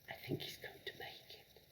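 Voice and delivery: soft unvoiced whisper; hopeful secret whisper. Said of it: "Matilda whispered".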